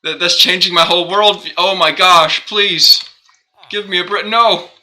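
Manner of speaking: hammed-up outburst, begging for a break; mock exasperation. Streamer mocking his own reaction with high, loud exasperation. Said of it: "I'm like".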